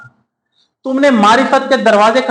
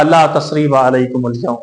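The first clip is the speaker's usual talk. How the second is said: gentle forgiving quote; 7 semitones lower than usual. Acted. Yusuf forgives his brothers.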